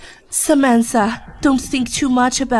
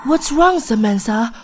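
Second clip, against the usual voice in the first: normal talking voice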